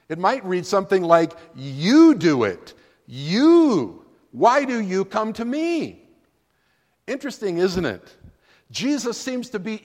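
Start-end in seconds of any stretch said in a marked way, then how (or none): 1.5-6.1 "You" hit hard, slow and louder; stress on you. Shown as a demonstration. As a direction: punch every "You", make it an order. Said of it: "the emphasis is on the word you".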